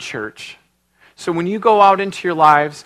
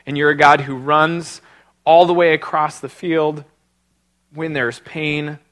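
closing prayer; 4 semitones lower than usual. Acted.

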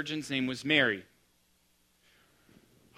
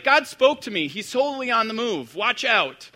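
put-on higher, louder excited girl; gushing teen post; 6 semitones higher, 6 dB louder than usual. Pastor mimicking a teen girl's post, excited. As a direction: read it like an eager fourteen-year-old. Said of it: "that's like".

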